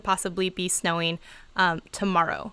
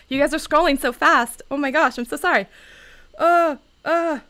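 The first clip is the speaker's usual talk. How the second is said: flustered panic; 6 semitones higher, 4 dB louder than usual. Real, not acted. Chat scrolling too fast.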